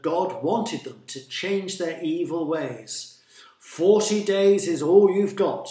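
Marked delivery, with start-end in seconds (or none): 3.6-5.7 loud, raised-pitch stern warning. A narrator's reading. Jonah to the people of Nineveh, warning. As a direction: proclaim the deadline forcefully to a crowd. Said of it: "he warned them".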